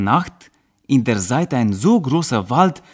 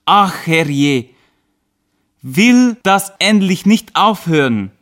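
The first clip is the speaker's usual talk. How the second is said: rising, louder cry of dismay; a man wailing at the bleeding wound; 5 semitones higher, 4 dB louder, slower than usual. Acted. (erschrockener, lauter Ausruf)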